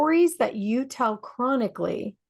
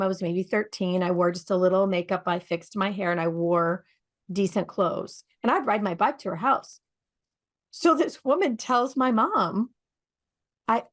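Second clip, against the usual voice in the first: ordinary talk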